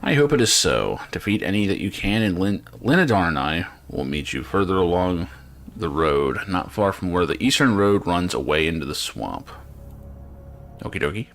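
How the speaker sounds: usual speaking voice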